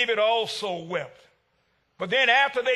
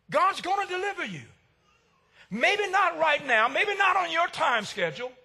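higher, emphatic preaching; rising assurance; 5 semitones higher than usual. Real, not acted. Preacher assuring the hurting church God will deliver. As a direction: lift it with conviction.

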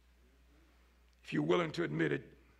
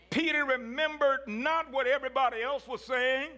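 rising preacher cadence, a little louder; preaching build; 7 semitones higher, 3 dB louder than usual. Acted. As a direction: climb toward the pulpit peak. Preacher rising to full force.

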